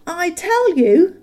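high, loud, slow hobbit voice; a small hobbit bravely keeping his end up; Bilbo standing up to the dragon. A narrator's reading. Bilbo insisting to Smaug, high and loud. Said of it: "in an effort to remain loyal".

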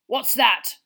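loud, suspicious demand; snarl. A narrator's reading. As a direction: bark it at the boy. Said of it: "he snarled".

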